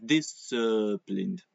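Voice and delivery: disciplined slowed down, every syllable spelled out; slow teacher demo of disciplined; over exaggerated. Shown as a demonstration. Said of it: "I'm over exaggerating it".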